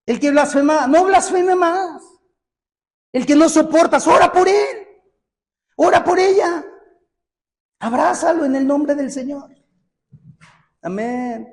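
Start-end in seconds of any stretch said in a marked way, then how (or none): 0.1-9.5 impassioned pulpit exhortation; full-force preaching. Acted. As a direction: preach it out to the congregation. Preaching call to forgive.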